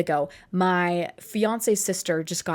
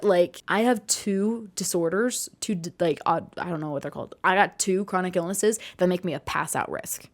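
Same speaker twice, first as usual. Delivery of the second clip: usual speaking voice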